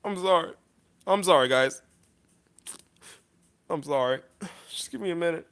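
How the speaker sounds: halting, subdued apologies; overcome with emotion. Real, not acted. Vlogger asking viewers for a minute, choked up. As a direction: apologize softly, pausing to regain composure. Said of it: "it just makes me so emotional".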